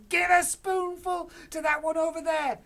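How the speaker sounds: very high, loud call; Mister Cranky calls to George, high and loud; eager cry. Acted. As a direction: urge George on.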